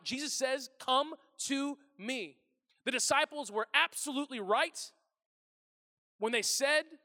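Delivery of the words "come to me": "Come to me" very slow, word by word, raised pitch; slow emphasis. Shown as a demonstration. Preacher repeating Jesus' invitation, "Come to me" slowly emphasized. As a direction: space out "Come to me", weighting each word.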